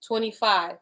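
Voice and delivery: ordinary talk, nothing marked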